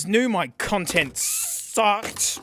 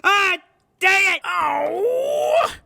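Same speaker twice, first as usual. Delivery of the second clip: high loud cries of frustration; frustrated cry; 10 semitones higher, 4 dB louder, much slower than usual. Real, not acted. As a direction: cry out as it breaks. Another speaker fails, crying out.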